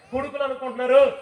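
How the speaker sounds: ordinary talk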